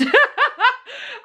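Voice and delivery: high giggling burst; sudden high laugh at herself; burst of laughter. Real, not acted. Laughing at own money stress.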